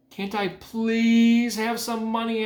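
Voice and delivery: put-on high, slow little-girl plea; pleading child. A narrator's reading. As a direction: beg sweetly like a child. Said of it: "asked Fern".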